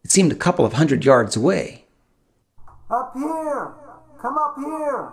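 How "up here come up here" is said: much higher, much softer call from a distance; far-off call. A narrator's reading. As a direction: call out from a couple hundred yards away. Distant voice calling from uphill.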